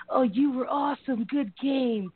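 high, hyped-up teammate praise; mock locker-room praise. Imitating a teammate praising a player after the game, high and hyped.

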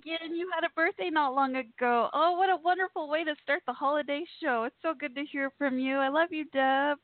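gushing excitement. Real, not acted. Host gushes to dear caller.

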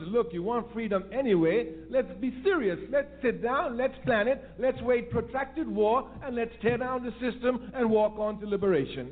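rally them to plan and act; urgent exhortation. Acted. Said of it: "What we must do is make them conscious".

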